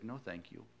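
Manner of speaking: a soft, courteous no; quietly declining a call to start a church; polite refusal. Retold or imitated. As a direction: turn God down politely, gently. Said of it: "and I said it very politely".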